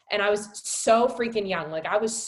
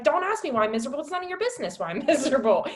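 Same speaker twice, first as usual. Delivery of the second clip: high, defensive snapping, laugh slipping in; mock-testy comeback, pitched up; retold irritation; 7 semitones higher, 3 dB louder, faster than usual. Wife answers husband asking what's wrong, mock-irritated and higher.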